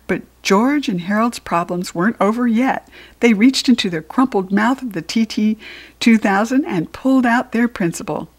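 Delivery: ordinary delivery, nothing marked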